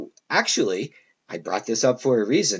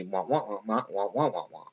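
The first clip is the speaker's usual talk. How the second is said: wah-wah mumble; 6 dB quieter than usual. Retold or imitated. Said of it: "you might just hear from me".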